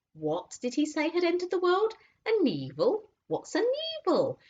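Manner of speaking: a confused beast mishearing Aslan; puzzled animal voices. A narrator's reading. Animals puzzled over Aslan's words, high.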